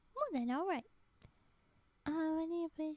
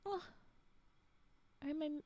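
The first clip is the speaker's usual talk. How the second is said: ordinary delivery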